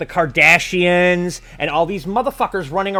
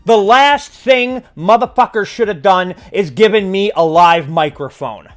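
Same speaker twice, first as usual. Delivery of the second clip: angry rant; 2 semitones higher, 4 dB louder than usual. Real, not acted. Ranting, building to loud.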